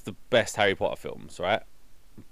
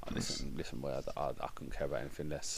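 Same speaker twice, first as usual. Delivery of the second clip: hushed mock voice; 8 semitones lower, 13 dB quieter, faster than usual. S0 mocking Hatch's voice.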